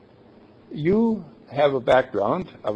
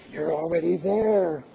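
soft, hushed reassurance into an ear; gentle hushed whisper-like aside; retold whisper; 6 dB quieter than usual. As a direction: murmur it as if close to her ear. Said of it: "I whispered in her ear".

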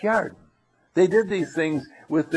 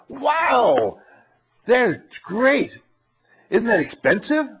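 put-on amazed kid; 9 semitones higher, much slower than usual. Classmate impressed by computer.

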